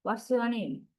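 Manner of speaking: "What's your name" stepping down to a low "name"; falling intonation. Shown as a demonstration. Teacher repeating a WH question for students, falling intonation. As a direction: let "name" drop, a WH question. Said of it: "That's falling intonation".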